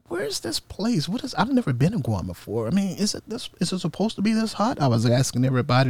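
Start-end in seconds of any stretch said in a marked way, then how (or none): 0.1-4.8 high, soft, wondering voice; high squeaky quote. Retold or imitated. Sailor fresh off the ship asking about the heat, high and soft. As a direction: squeak it out like a lost newcomer. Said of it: "And I was like".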